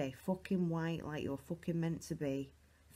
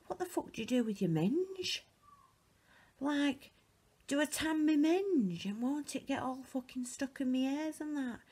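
higher-pitched, mock-panicky worrying; retold panic; 6 semitones higher than usual. Recounting her thoughts while tanning, mock panicky. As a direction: act out your own rising panic. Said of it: "I got all dead panicky".

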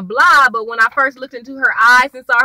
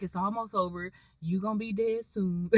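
much softer, cold and hushed; menacing hush; 5 semitones lower, 15 dB quieter than usual. Retold or imitated. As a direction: quietly tell the dying man it's over. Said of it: "he was like".

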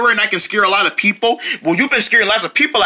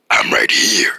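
creepy lurking voice; 14 semitones lower, slower than usual. Pretend. Lurking before scare.

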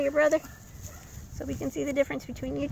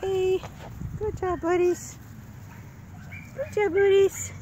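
puppy talk; 7 semitones higher, slower than usual. Real, not acted. Praising puppies.

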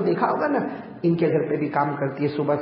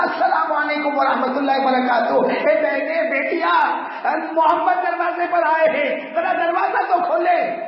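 high, loud third call at the door; 10 semitones higher than usual. Retold or imitated. Prophet calling a third time, loudly, to the closed doors. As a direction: raise the voice to call through the door. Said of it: "تیسری مرتبہ بلند آواز سے کہا".

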